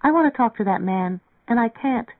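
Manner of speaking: put-on young woman confessing she can't talk to him; earnest young girl. A narrator's reading. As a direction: say it simply and earnestly. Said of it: "Mary Ann went on earnestly".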